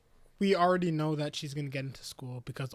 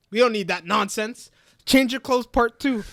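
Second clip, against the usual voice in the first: building to a loud, higher mock declaration; retold dismissal; 6 semitones higher, 8 dB louder than usual. Family waving off the interviewer, loudly declaring. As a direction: grandly brush it off, louder as it goes. Retold brush-off, voice rising louder.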